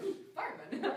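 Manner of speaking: mock swoon through real laughter; laughing ooh. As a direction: giggle out the admiring ooh. Retells the thought laughing.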